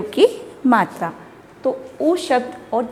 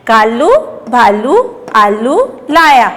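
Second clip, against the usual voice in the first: word by word, long vowels stretched and rising; slow primer reading; 4 dB louder, slower than usual. Shown as a demonstration. Teacher reading for children to repeat, slow and segmented. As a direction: read slowly with a pause after each word.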